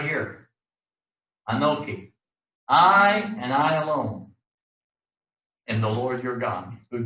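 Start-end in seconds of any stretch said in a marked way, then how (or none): 2.7-4.3 slow, with both I's stressed; emphatic I. Shown as a demonstration. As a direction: press on each I. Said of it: "this is the emphatic I".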